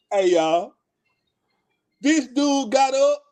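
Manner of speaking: ordinary delivery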